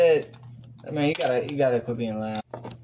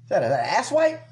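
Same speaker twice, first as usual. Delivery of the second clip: mocking question; 6 semitones higher than usual. Mocking cohost's headwear.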